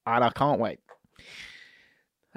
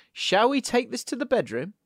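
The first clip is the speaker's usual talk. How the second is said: muffled; 8 semitones higher than usual. Retold or imitated. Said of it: "A muffled".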